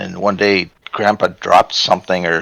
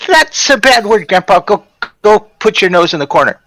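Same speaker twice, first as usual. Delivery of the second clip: put-on high, bossy little-girl voice; scolding toddler; 10 semitones higher, 3 dB louder than usual. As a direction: imitate a toddler sternly sending Grandpa off. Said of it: "a picture of toddler Amber".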